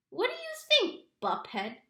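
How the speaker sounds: high mocking teen voice; teasing big brother. A narrator's reading. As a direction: sneer it like a bully.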